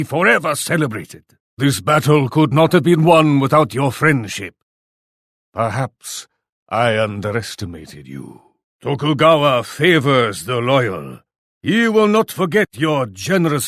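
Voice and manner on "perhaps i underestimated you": much lower and softer, a reluctant concession; grudging admission. Acted. Warlord grudgingly admitting he misjudged his ally, low and soft. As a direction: concede it quietly, almost to yourself.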